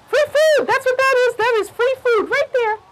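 high, squeaky mouse voice, delighted; tiny mouse. Acted. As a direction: squeak it out like a greedy little mouse. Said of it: "And she thought".

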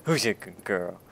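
drawn-out praise, starting very loud; slow, playful pet-owner praise; talking to a dog. Pretend. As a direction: gush at the player like a good dog. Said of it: "Don't talk to me like I'm a dog".

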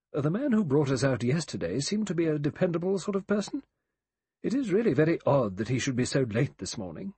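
prim Emily Brent. Acted. Emily Brent remarking to Vera on the late boat, prim.

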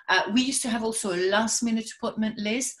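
ordinary delivery

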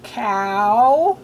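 "Cow" stretched on OW, rising; isolated "Cow", long lifted vowel; drilling OW words. Shown as a demonstration. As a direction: draw out the OW in "Cow" with a lift. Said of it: "say the ow words with me".

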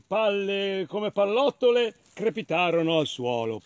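ordinary delivery

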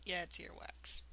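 whispered in revulsion; disgusted whisper. Real, not acted. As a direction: breathe it out, grossed out.

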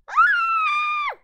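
very high, very loud shriek of joy; very high, loud shriek of glee; excited scream. Real, not acted. Screams while freaking out.

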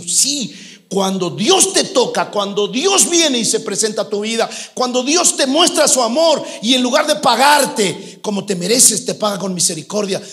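loud, high-pitched sermon at full force; fervent preaching. Acted. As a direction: drive it home to the congregation.

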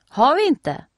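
high, loud triumphant exclamation. A narrator's reading. Nelly shows off the swimsuit, triumphant and high. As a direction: crow it like you've won. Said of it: "utropar Nelly triumferande".

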